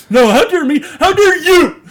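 high, loud mock-tearful yelling; overacted outrage. Mimicking Peter's overacted movie outburst, hammed-up outrage. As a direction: ham up a melodramatic outburst. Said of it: "the most overacted scene I've ever seen".

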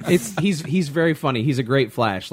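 ordinary talk

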